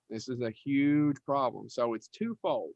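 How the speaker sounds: usual speaking voice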